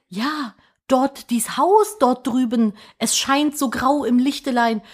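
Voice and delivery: hoch, langsam, feierlich warnend; Märchenerzähler. Acted. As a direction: wie ein Dorfprediger im Mittelalter. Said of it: "Leute ... denken sich so". (altertümlicher Warnruf)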